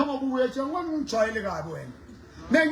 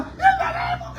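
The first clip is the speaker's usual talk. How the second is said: high-pitched grandmother. Retold or imitated. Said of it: "Nkgono wa teng a la".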